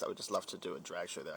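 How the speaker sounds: usual speaking voice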